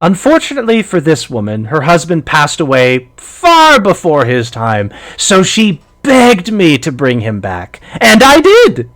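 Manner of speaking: sing-song crone, swooping up high; wistful witch, gloating at the end; old hag voice. Acted. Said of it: "She says".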